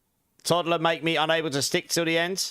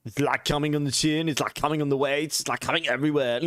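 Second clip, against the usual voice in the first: lightly voiced Austrian action hero; a hint of Arnold's accent; Arnold Schwarzenegger. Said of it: "my awesome Arnold impression".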